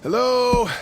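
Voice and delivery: loud, high hello; calling out. Acted. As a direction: call out into the house. Calls into house.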